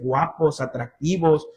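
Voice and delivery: ordinary talk, nothing marked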